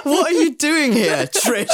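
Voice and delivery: high, laughing through the self-reproach. Retold or imitated. Telling herself off for blagging in, laughing.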